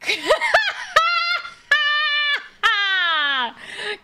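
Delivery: high shrieking burst of laughter; cackle-shriek at the failed move. Real, not acted. Player laughing at a fail, high shriek of laughter.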